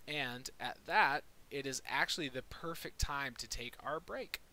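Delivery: ordinary delivery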